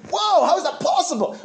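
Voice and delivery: high, astonished exclamation; mock amazement. Preacher playing a listener stunned by fluent languages, amazed. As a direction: act floored, pitch leaping up. Acts out amazement at perfect foreign speech.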